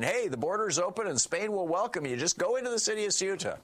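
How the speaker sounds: high, excited mock come-on; retold breathless-news urging, swooping high; eager invitation. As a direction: pitch it up like an excited viral video.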